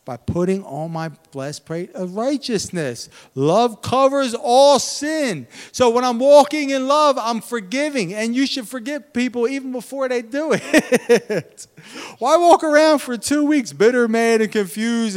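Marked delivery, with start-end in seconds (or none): 3.3-15.2 high, loud preacher cry; fervent preaching. Acted. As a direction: build the sermon up. Preaching at full force.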